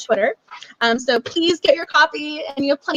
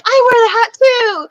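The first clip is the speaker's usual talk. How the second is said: high, loud, thrilled gushing quote; bursting with glee over the matching hat; excited fan quote; 10 semitones higher, 6 dB louder than usual. Retold or imitated. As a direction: squeal it like a delighted fellow fan. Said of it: "she was like".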